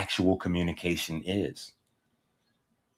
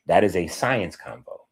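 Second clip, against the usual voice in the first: usual speaking voice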